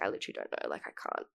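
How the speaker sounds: whispered, deflated aside; a tired whispered shrug of an answer; exasperated whisper. Retold or imitated. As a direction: breathe it out like you're giving up. Retold reply to nosy Uber drivers.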